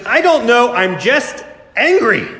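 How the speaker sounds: lightly voiced sulky child; sulky little niece. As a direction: play the grumpy little girl. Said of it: "she said".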